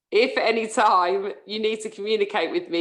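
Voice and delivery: ordinary talk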